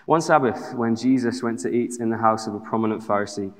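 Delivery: usual speaking voice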